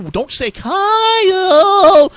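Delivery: high, slow, drawn-out squeak on Kyle; gnome voice. Acted. Gnome warning the digger, high and slow. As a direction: stretch Kyle out high and eerie.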